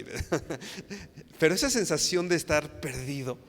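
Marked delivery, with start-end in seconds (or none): 0.1-3.4 laughing through the line, a bit louder. Real, not acted. Preacher laughs with the recalling congregation, laughing. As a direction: chuckle as you say it. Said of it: "ya se están recordando ahí".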